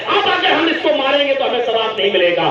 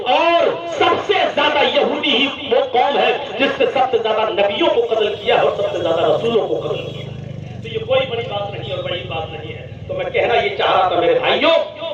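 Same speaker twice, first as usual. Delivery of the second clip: normal talking voice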